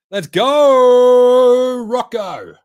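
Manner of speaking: high, loud, drawn out go; real fan bellow; cheering shout. Real, not acted. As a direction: yell it at the screen. Cheering the pick.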